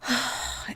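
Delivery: long weary sigh; heavy sigh. Real, not acted. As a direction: let out a tired sigh. Sigh alone.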